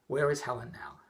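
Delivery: soft, low and calm. Retold or imitated. Husband calmly questioning his wife after her yelling. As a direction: ask it gently after the yelling. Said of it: "I said calmly".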